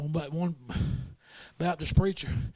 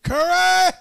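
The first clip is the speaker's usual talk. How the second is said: sharp raised call of a surname; coach barking name; 13 semitones higher, 6 dB louder than usual. Retold or imitated. Angry coach calling his player over.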